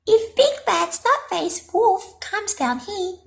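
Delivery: high squeaky scheming voice; plotting in a high piggy voice; sly pigs. A narrator's reading. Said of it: "they chuckled".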